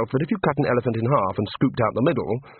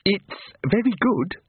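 forced out; 8 semitones higher, slower than usual. A narrator's reading. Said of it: "he managed".

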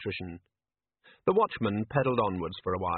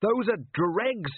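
mild shout; 11 semitones higher, much slower than usual. A narrator's reading. Jenkins shouts at Carrot, a restrained high call. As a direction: call it out slowly, pitched high. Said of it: "he shouted".

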